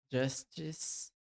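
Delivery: ordinary delivery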